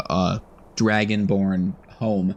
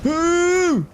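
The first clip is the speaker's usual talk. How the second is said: surprised ooh; 4 dB louder than usual. Real, not acted. Hit during fight.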